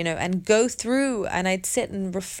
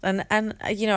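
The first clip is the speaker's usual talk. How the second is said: usual speaking voice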